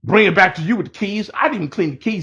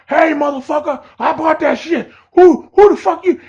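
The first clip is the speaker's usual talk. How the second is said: angry yell; 10 semitones higher, 4 dB louder than usual. Retold or imitated. Angry buyers confront the wrong guy.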